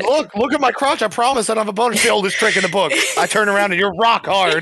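laughing mock plea. Acting out the stalker scene.

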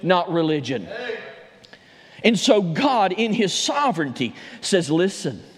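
rising Southern preacher's fervor; preaching. Acted. Pastor preaching to his church, full fervor. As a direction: build the message toward the altar call.

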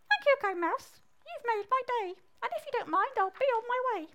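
high-pitched squeaky frog. Acted. Frog thanks the mouse and hops off, high squeaky voice. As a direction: chirp it high as a little frog.

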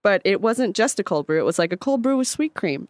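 usual speaking voice

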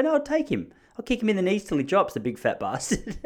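high-pitched hammed-up tough talk; mock bravado. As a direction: puff up with comic fighting bluster, starting very high. Acting out his fighting bravado.

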